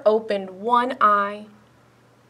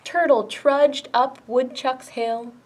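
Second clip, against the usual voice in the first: usual speaking voice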